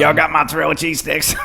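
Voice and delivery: high, silly slurred question; goofy drunk voice. Pretend. Joking mock drunk line.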